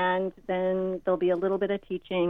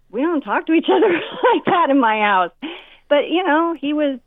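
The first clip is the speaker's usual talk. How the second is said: higher, louder, laughing through it; 9 semitones higher, 8 dB louder than usual. Real, not acted. Mom recalling her rude son, laughing. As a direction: laugh at the memory as you say it.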